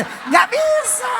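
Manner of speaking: usual speaking voice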